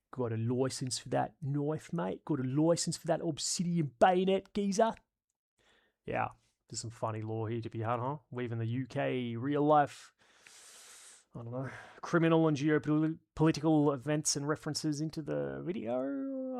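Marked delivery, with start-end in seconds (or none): 0.1-4.9 rising, pushy London geezer voice; mock London geezer. Mocking London knife-crime lines in a rising geezer voice. 15.0-16.6 high, slow stretched words; high drawn-out. Acted. Joking aside on weaving UK references in, high and slow.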